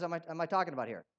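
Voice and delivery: ordinary delivery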